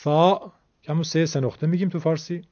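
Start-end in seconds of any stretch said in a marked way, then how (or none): 0.0-0.5 "ثا" with Arabic th as in think; tongue tip to teeth for "ثا"; Arabic letter name. Shown as a demonstration. Teacher lists special Arabic letters, "ثا" lisped as th. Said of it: "اون ده حرف عبارتند از".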